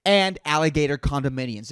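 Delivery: ordinary talk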